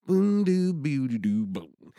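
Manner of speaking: high scat riff imitation; sung guitar riff. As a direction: vocalize the guitar lick.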